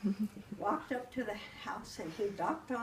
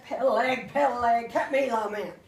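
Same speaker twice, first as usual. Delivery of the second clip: loud, growled wolf call at the door; wolf shouting at the pig's door; big bad wolf; 10 dB louder than usual. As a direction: demand entry like a hungry wolf.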